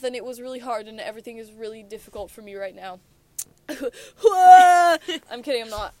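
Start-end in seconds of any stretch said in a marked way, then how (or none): 3.7-5.2 loud, high mock wail; hammed-up cry, played as a joke; fake crying. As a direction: burst into a badly faked sob. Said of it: "that was fake".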